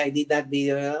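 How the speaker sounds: normal talking voice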